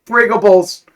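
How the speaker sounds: high, loud, proud code word; mock secret password. Hero saying the codeword into the phone, proud. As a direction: announce it like a password.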